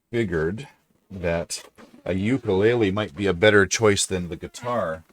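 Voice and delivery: ordinary talk, nothing marked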